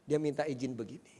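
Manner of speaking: normal talking voice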